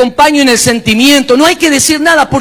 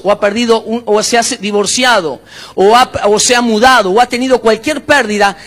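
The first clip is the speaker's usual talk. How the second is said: ordinary talk, nothing marked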